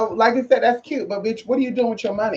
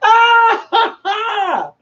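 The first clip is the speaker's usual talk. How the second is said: high shout; 14 semitones higher, 3 dB louder than usual. Real, not acted. Sudden exclamation.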